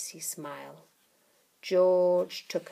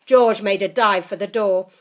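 ordinary delivery, nothing marked

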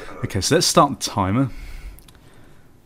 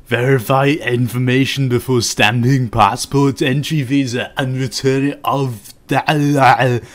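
Arnold Schwarzenegger; 6 dB louder than usual. Acted. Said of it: "Turning into Arnold Schwarzenegger now".

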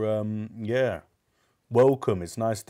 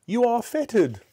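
high, frightened and louder Scrooge; trembling fear; 5 dB louder than usual. Acted. Scrooge noticing the ghost's chains, high and trembling. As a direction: say it trembling, pitched right up.

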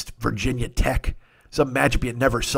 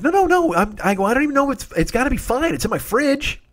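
pitched way up, rushing to reassure; frantic soothing; 8 semitones higher than usual. Retold or imitated. Calming his upset friend, high and frantic. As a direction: talk her down in a high panic. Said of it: "I'm like".

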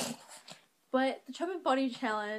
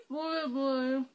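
mouth full. Real, not acted.

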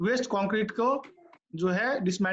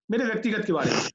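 ordinary talk, nothing marked

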